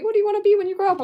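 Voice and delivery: high, loud put-on questioning voice; exaggerated high-pitched quoted question; mock questioner. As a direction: pipe it up loudly like an eager questioner. Acting someone asking her, loud and high.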